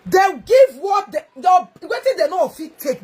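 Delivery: slow, high, loud shouted opener; angry shout. Real, not acted. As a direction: shout it out in anger, dragging the words. Angry rant at Peter Ituna.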